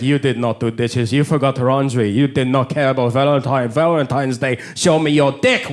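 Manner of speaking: put-on low, choppy Japanese-accented English; put-on Japanese accent, deliberate and low; girlfriend's Japanese accent. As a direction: imitate your Japanese girlfriend scolding you. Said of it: "She's like".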